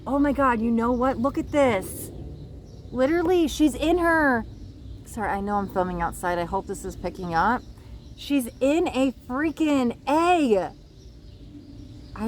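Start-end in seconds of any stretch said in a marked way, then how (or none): 0.0-4.4 high-pitched amazed realization; real wonder, voice jumping up; excited discovery. Real, not acted. 8.2-10.7 slow, high-pitched astonished reveal; real gobsmacked excitement; amazed. Real, not acted. Amazed discovery on the card.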